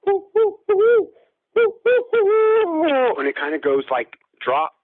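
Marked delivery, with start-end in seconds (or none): 0.1-3.1 high loud hoots dropping down at the end; angry owl sound. Retold or imitated. Mimicking the angry owl for the host, high loud hoots. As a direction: do the angry owl hoot and let it fall. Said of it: "the owl goes".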